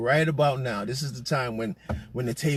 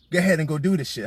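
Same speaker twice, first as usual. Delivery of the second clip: ordinary talk, nothing marked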